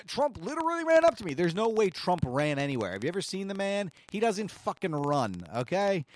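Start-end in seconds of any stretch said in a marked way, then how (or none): 0.1-1.3 high, hurried mocking echo; mock quote. Host mocks the guest's claim about Trump, high mocking quote. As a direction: parrot his boast in a high, rushed voice. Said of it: "I mean, you heard him".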